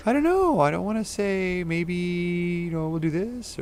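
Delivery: high, shy, hesitant mock-viewer voice; bashful viewer. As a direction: play a timid viewer who won't commit. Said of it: "everyone's a little bashful".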